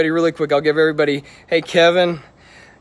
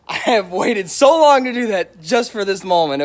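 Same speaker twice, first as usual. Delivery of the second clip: louder, hyped-up retake; pumped, a bit louder and higher; excited; 5 semitones higher, 4 dB louder than usual. Real, not acted. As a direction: push it with live-stream excitement. Repeats line, building hype.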